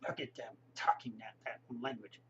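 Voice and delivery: hushed snide whisper; mocking whisper. Locals sneering at foreigners, in a snide whisper. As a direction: whisper it behind their hand, sneering. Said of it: "the people here get all".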